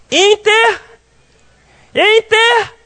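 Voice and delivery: "Inter-" left hanging on a high rise; prompting the class. Real, not acted. Prompts class to finish the word. (puxando a resposta da turma, "Inter-" em tom alto e suspenso)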